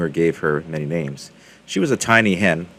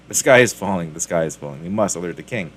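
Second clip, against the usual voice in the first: usual speaking voice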